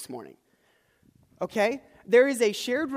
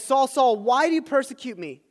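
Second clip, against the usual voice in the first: raised, louder voice calling him out; commanding voice from heaven; 4 semitones higher, 6 dB louder than usual. A narrator's reading. A heavenly voice confronts Saul on the road, commanding. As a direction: call out urgently, pitched up, as a divine challenge.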